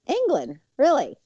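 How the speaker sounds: high, incredulous double take; disbelief. Retold or imitated. Student hearing of the England trip, a high, incredulous double take. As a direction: sound stunned at the news. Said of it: "I was like".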